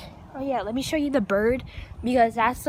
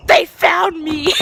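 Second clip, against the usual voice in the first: very loud, drawn-out yell; alarmed shout; 4 semitones higher, 13 dB louder, slower than usual. Real, not acted. Climber fearing the cops are coming, shouting in alarm. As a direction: shout it out as if caught.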